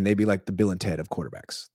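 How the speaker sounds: ordinary talk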